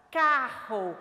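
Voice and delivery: "carro" loud, with a strong guttural rr. Shown as a demonstration. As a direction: hit the double r in "carro" hard and loud. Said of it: "Você viu que mudou o som?". ("carro" forte, com o rr bem marcado)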